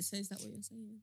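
very soft, wounded question; playing the victim. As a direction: ask it quietly, as the wronged party. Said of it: "You play victim".

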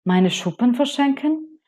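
as if the idea were outrageous; appalled. Acted. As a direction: rainbow fish aghast at giving scales away. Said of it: "dachte der Regenbogenfisch entsetzt".

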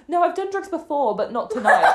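put-on high-pitched, loud drunken protest; imitated drunk self. Spiked woman answering the ambulance woman, imitated drunk protest. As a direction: play yourself drunk, ending near laughter. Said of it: "fucked and, like, probably a bit spiked".

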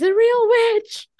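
put-on high, loud squealing kid voice; frightened kid voice. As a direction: squeal like a scared child. Kids' squealing voice continued.

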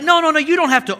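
high, loud mock protest; hammed-up modest refusal; polite refusal. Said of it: "I was like".

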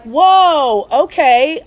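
high, loud startled whoa that rises at the end. Retold or imitated. Hearing a customer may get hurt, a startled whoa. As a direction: react like the news just hit you. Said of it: "So I'm like".

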